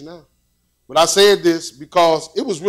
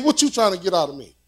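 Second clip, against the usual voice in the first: ordinary talk, nothing marked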